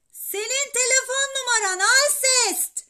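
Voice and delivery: high, very loud yell into the phone; boy shouting to be understood. A narrator's reading. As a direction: bellow to get through. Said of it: "diye bağırdım telefona".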